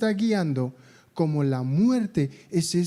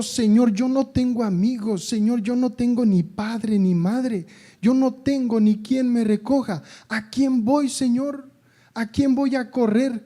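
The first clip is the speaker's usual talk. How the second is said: pleading Peter; 5 semitones higher than usual. Acted. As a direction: beg him as if nothing else remains.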